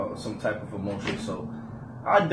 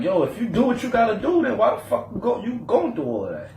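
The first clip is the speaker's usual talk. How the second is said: exasperated; 10 semitones higher than usual. Real, not acted. Exasperated rant.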